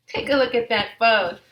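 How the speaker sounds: ordinary delivery, nothing marked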